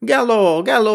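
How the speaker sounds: high, loud alarmed cry; panicked wail. Acted. Habul cries out as Tenida is lifted, panicked wail. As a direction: howl out in sudden panic. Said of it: "হাউ মাউ করে ডাক ছাড়ল হাবুল".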